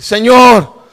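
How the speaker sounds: loud, drawn-out call to God; shouted plea; retold cry out. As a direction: cry out in prayer. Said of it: "y yo empecé a gritar".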